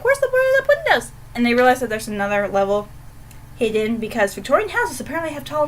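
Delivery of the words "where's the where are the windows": quoted Cleosa. Retold or imitated. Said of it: "Cleosa's like".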